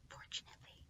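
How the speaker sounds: soft whispered aside. Real, not acted. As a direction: breathe the word to the listener. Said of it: "unvoiced whisper".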